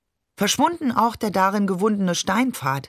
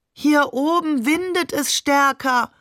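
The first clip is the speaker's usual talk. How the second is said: slow, raised-pitch great-grandfather quote; higher, slower character voice for great-grandfather; voiced great-grandfather; 7 semitones higher than usual. A narrator's reading. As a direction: lightly act the tall great-grandfather, drawn out and higher. Great-grandfather calls down to the child.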